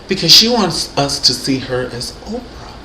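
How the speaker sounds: normal talking voice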